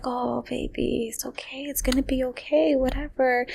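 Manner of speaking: soft, half-whispered reassurance; soothing nurse. Retold or imitated. Nurse soothing her in hospital, retold.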